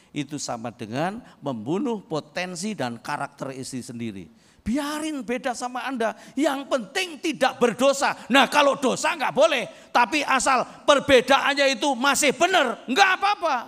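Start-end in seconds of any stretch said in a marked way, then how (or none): 4.6-13.6 higher, louder, fast preacher's shout; preaching at full force. Acted. Preacher exhorts husbands to help their wives, loud fervent shout. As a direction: hammer the point home to the men.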